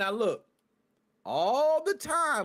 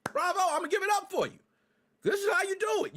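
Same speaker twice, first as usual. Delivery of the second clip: sarcastic praise; 9 semitones higher than usual. Real, not acted. Sarcastic applause for the agency.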